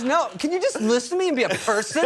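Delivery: high-pitched, imitated indignation; mock exasperated plea. Woman begging bachelor to just listen, hammed-up exasperation. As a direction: plead with someone who will not listen. She scolds bachelor in retold quarrel.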